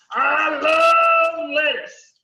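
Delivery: loud, slow puppet character voice. Pretend. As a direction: stretch it out in a silly puppet voice. Puppet Antonio loves lettuce.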